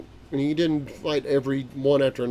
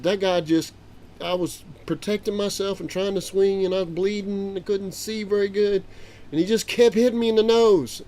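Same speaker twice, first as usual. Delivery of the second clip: excited retelling; 7 semitones higher than usual. Retold or imitated. Grandpa reliving the beating.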